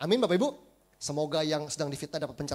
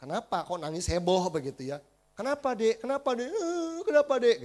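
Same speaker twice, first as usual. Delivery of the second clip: put-on concerned passers-by asking the crying kid, rising higher with each question; curious bystanders; 5 semitones higher, 6 dB louder than usual. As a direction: ask the child what's wrong, more urgent each time. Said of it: "Orang mulai datang". (orang-orang bertanya cemas, makin tinggi dan mendesak)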